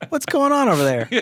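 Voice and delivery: high, playful mock boss voice; mock nosy boss. Cohost mocks a boss checking on a slacker, high and playful. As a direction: teasingly play the hovering boss.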